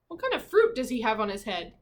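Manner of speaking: usual speaking voice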